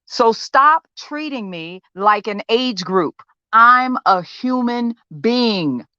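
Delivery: understated, wounded defiance in a monologue; frustrated patient confronting therapist. Acted. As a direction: make your case to someone who won't listen, pausing between points. Audition monologue from a play.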